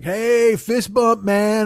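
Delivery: loud, high, acted hail to a buddy; boisterous greeting. The ace greeting a big bouncer, boisterous. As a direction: imitate an overfamiliar guy calling out hello.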